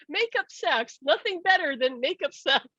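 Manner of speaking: high, gleeful quote of a teasing friend; playful teasing. Retold or imitated. Friend joking after the couple's argument, playful teasing. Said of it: "she used to tease me".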